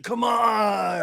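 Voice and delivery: very high, drawn-out protest yell; hammed-up high wail of protest; mock pleading whine. [name] begging to keep her prop guns, mock pleading yell.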